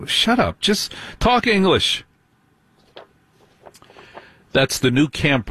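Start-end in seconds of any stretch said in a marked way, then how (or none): 0.1-2.0 high-pitched exasperated outburst; exasperated retort. Acted. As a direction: cut them off, fed up, pitch jumping up. Mock retort to slang talkers.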